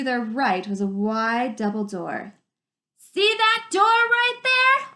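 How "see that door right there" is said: high and loud, slow, calling over the rush of water; shouting over the river. Acted. Arlen shouting to Dune over the river, pointing at the door. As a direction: shout and point across the noise. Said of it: "Arlen shouted".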